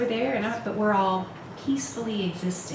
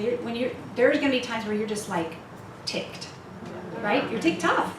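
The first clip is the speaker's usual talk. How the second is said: ordinary talk, nothing marked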